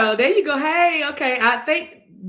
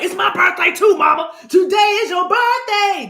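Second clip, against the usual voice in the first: loud, higher mock yell; mock shouted claim; 6 semitones higher, 7 dB louder than usual. Viewers demanding cards too, mock shouting. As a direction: shout it like a jealous kid. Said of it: "everybody gonna be like".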